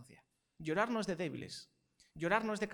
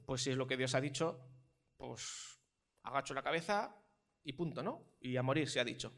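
resigned shrug; 5 semitones lower than usual. Pretend. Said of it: "hubiera dicho".

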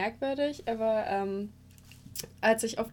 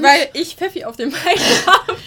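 laughing; 7 semitones higher, 14 dB louder than usual. Real, not acted. Laughing about spilled schnapps.

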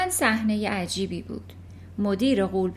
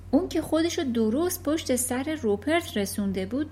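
ordinary delivery, nothing marked

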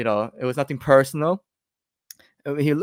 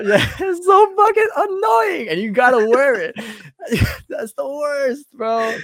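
laughing; 12 semitones higher, slower than usual. Real, not acted. Laughing complaint about flip-flops.

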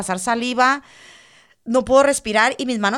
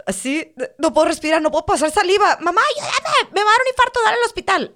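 high, loud gasping panic; reenacted frantic, high-pitched plea for help; panicked re-enactment; 10 semitones higher, 4 dB louder than usual. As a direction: cry out to mom as if having a heart attack. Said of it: "empiezo, me va a dar un infarto".

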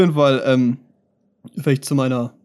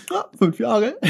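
high, laughing through the words; 8 semitones higher, slower than usual. Real, not acted. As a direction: giggle it out. Laughing about long studies.

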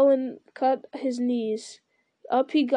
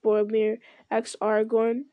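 usual speaking voice

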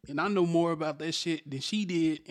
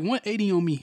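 normal talking voice

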